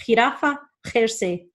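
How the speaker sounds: jirafa and jersey opening on a throaty H; Spanish J in jirafa, jersey; guttural J. Shown as a demonstration. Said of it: "really need to practice that one".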